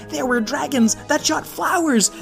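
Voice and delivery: usual speaking voice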